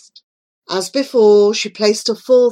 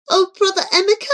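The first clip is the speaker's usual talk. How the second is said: weeping; 12 semitones higher, much faster than usual. Acted. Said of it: "Joy wept".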